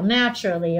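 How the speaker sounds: ordinary delivery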